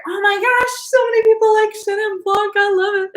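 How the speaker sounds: high, quick excited gush; delighted gushing. Real, not acted. Wine host thrilled attendees like Chenin Blanc, delighted gushing.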